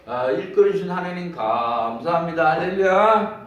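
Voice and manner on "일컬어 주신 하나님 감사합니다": ordinary talk, nothing marked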